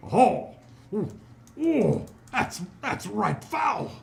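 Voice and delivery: loud, high, drawn-out disgust; disgusted dwarf constable. Acted. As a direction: recoil loudly from a foul stench. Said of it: "and he goes".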